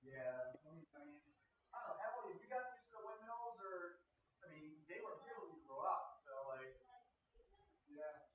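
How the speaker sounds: distant, off-mic voice; calling from the next room; from another room. Real, not acted. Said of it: "You can hear me in the other room".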